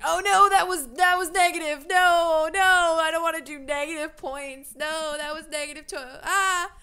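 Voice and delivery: high, loud panicked no; dismayed outcry. Real, not acted. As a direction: yelp in dismay at a mistake. Wrong dialogue choice picked.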